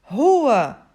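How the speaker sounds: loud, high shout of a furious boss; angry shout. Shown as a demonstration. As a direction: bark the one word like firing someone on the spot. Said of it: "o dono da empresa olha pra ele e grita".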